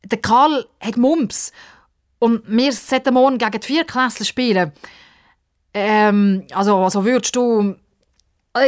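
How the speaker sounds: put-on a shy boy asking for help; boy hesitantly asking a girl to join the team. Bruno voiced, hesitant.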